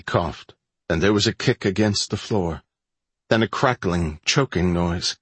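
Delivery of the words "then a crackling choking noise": ordinary delivery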